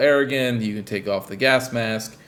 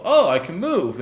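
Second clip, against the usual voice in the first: sudden high surprised realization; 8 semitones higher, 4 dB louder than usual. Retold or imitated. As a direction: say it like you just got it.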